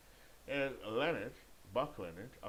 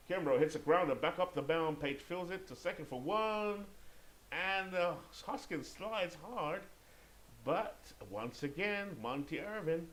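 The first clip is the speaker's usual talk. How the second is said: raised, louder baseball call, drawn-out words; excited play-by-play; 7 semitones higher, 3 dB louder, slower than usual. Pretend. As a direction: call the play as it happens, stretching the big words.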